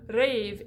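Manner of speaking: rêve mispronounced as "rave"; rêve with closed, gliding E; closed vowel. Shown as a demonstration. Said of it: "Instead of rêve or rêve".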